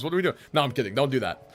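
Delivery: normal talking voice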